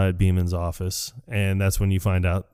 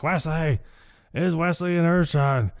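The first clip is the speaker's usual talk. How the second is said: retold drunken yell; 8 semitones higher than usual. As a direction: call down like a drunk on a ledge. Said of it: "he's yelling things like".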